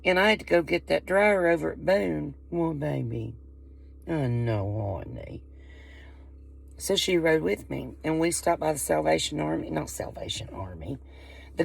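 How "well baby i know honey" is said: soft, fading coo to a pet; speaking to her cat, softer and lower. Real, not acted. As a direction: sweet-talk the cat, trailing off low.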